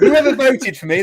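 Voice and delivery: high, loud, through laughter; laughing mock gratitude. Real, not acted. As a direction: address the lone voter while laughing. Losing the vote, group laughing.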